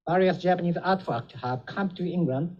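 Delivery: normal talking voice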